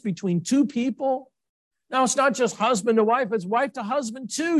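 impassioned sermon climax; fervent preaching. Acted. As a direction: lift the congregation with fervour. Preacher exhorts the congregation.

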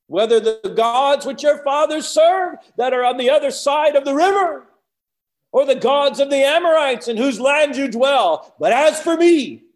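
high, sustained open-air shout; full-force prophet's declaration; booming proclamation. Acted. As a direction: holler the verse to a huge crowd. Acting Joshua's speech to the nation.

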